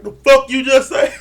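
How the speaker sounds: high, loud indignant retort; angry retort. Retold or imitated. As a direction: fire it back, offended and loud. Simone snapping at Joy's jab.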